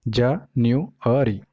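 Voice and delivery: जा-न्यू-अ-री slow, in separate pieces; syllable by syllable. Shown as a demonstration. Teacher counting four syllables for students, syllable by syllable. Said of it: "तो इसमें चार syllable है".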